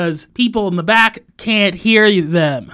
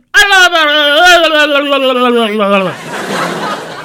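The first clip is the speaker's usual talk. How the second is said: loud laughter; 4 semitones higher, 6 dB louder than usual. Real, not acted. Laughing at own joke.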